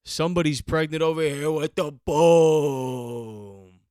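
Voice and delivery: slow, loud, drawn-out shout of the line; loud mock impression. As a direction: boom it out like the hype guy on the clip. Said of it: "Does he say".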